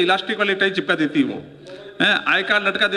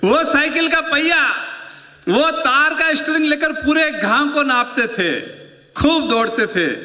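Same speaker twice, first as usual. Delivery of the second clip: excited memory; 8 semitones higher than usual. Real, not acted.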